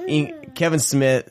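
ordinary talk

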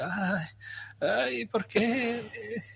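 high, soft, drawn-out mock lament; imitando mujeres desesperadas. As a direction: moan it slowly like a desperate Spanish woman. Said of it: "hablan así como desesperadas". (lamento agudo y lento, desesperado en burla)

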